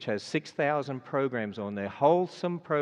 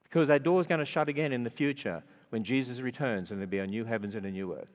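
preaching at full force. Acted. Preacher urging the congregation into the ark, full-force preaching. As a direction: drive the warning home to the congregation.

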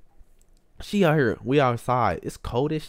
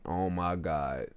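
low, soft, drawn-out groaning words; quiet, slow exasperation; dismayed aside; 6 semitones lower, 7 dB quieter, much slower than usual. Retold or imitated. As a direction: say it slowly under your breath in dread. Dreading mother's confrontation.

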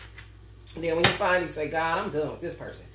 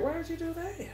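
ordinary delivery

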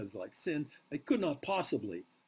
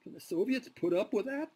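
a hint of Molotov, pitched higher; imagined Molotov; 9 semitones higher than usual. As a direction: lightly voice Molotov pressing Stalin. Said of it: "you can imagine Molotov saying this to Stalin".

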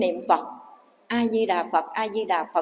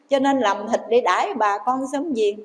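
ordinary delivery

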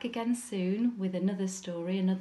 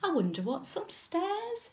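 lightly voiced high, wondering little girl; high, lilting curiosity; curious Goldilocks; 11 semitones higher than usual. A narrator's reading. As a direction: wonder aloud as a storybook child.

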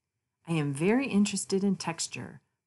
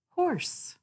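much higher, a neighing lift; playful high voice; 13 semitones higher than usual. Acted. Narrator names the horse to children in a playful high voice. Playful animal name reading.

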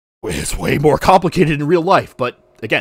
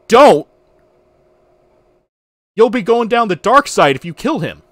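pleading warning; 6 semitones higher, 6 dB louder than usual. Retold or imitated. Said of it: "she goes".

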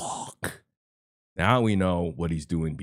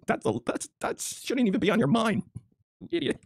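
much higher, incredulous scolding; exasperated squeak. Real, not acted. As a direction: scold like you can't believe it. Exasperated lecture.

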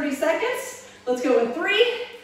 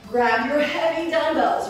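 ordinary talk, nothing marked

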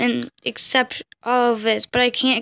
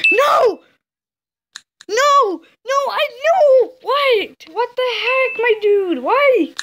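distressed shout; 10 semitones higher, slower than usual. Real, not acted. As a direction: yell at the screen in dismay. Friend says not be friends.

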